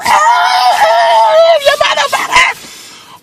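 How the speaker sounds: high, slow, drawn-out yell; chase shout. Retold or imitated. Chasing the car down the boulevard, high drawn-out yell. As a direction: holler it long at the fleeing car.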